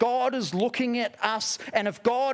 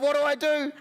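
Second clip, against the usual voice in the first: higher, more frantic repeat; mock panic; 8 semitones higher than usual. Lonely visitor spiralling, higher mock panic. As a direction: let the overthinking spiral up. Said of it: "I'm like".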